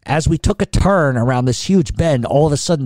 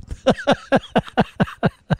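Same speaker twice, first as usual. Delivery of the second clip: long wheezy laugh; 12 semitones higher than usual. Real, not acted. Laughs after the repeated denial.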